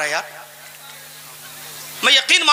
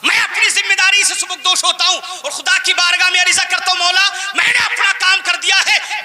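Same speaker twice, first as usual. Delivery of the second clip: raised, declaiming sermon voice; impassioned preaching; 7 semitones higher than usual. Real, not acted. Preacher telling God he has done his duty, impassioned preaching. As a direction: declaim it in one long high-held run.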